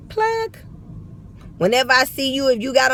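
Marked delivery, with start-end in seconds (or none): 0.1-0.6 high squeaky yelp of the word; sudden high ad-lib; plug ad-lib. Pretend. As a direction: pop it out like a hype-man tag. Shouted brand-plug ad-lib.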